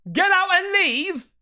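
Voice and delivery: high, loud yelled get out; panicked shout. Pretend. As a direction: scream at the ghost to leave. Shouting at the scary clip.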